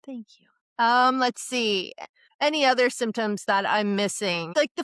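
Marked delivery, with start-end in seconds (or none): 0.1-0.5 awkward quiet thanks. Real, not acted. Taking a compliment she finds uncomfortable, softly. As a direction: thank you almost under the breath. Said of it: "It's uncomfortable".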